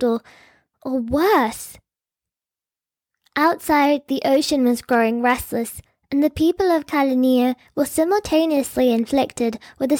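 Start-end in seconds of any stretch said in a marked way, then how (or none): none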